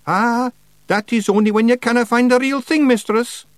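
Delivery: high, loud Scots Feegle, mildly careful. A narrator's reading. Feegle covers his lie to witch, careful. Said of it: "said Rob Anybody carefully".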